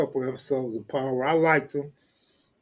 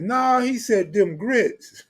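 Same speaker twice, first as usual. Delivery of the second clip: high, slow mock Southern insistence; high slowed imitation of a country voice; imitating Cartoon; 7 semitones higher, 3 dB louder, slower than usual. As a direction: drag it out, insisting. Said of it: "He like".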